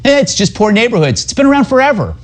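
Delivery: high-pitched, shrugging brush-off; dismissive mock media. Commentator parodies the media shrugging off gang violence, mock dismissive. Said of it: "the media dismisses it by saying".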